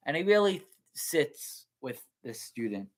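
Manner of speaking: usual speaking voice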